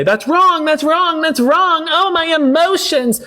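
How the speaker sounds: high whiny mock of a crybaby. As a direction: parody a whining crybaby. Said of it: "You can't just say".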